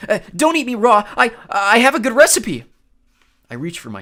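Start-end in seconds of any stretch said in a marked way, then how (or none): 0.1-2.7 high, loud desperate cry; panicked plea. Acted. Grover about to be eaten, high panicked plea. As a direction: beg in terror. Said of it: "Grover pleaded".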